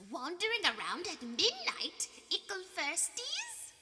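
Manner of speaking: high, taunting, sing-song poltergeist voice; high mischievous sneer, drawn out; mocking poltergeist. A narrator's reading. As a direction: tease them like a gleeful prankster spirit. Peeves voiced by narrator.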